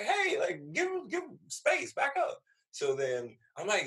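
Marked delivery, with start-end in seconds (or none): 0.0-2.4 high, insistent female voice; woman telling him off. Retold or imitated. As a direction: push back for her man, pitched high. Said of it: "She's like".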